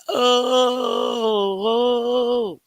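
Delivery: high, loud hammed-up sob of oh; exaggerated pretend weeping; mock crying oh. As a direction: fake a wailing cry. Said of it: "It make you say".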